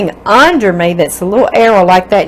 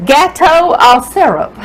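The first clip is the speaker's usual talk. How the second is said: gâteau au syrup in a hammed-up country French accent, higher with a laugh; 6 semitones higher than usual. Shown as a demonstration. Cook showing off her country French accent, laughing. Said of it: "that's my country French accent right there".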